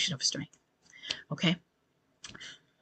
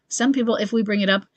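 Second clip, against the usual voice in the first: ordinary talk, nothing marked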